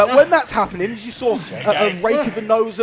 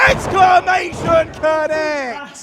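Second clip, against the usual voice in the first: wrestling commentator; 7 semitones higher than usual. Real, not acted. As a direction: shout the big move like a commentator. Excited wrestling commentary call.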